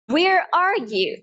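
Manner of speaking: slow American accent, strong R. Shown as a demonstration. Teacher models where are you, American accent. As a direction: stretch it out in a clear American accent. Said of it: "American accent में मैं बोलूंगी".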